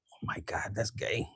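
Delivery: hushed mock gasp, whispering; voiceless scandalised whisper; whispered aside. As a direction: breathe it like a secret.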